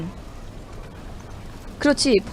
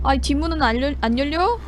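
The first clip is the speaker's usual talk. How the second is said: ordinary talk, nothing marked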